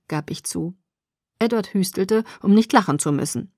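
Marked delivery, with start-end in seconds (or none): none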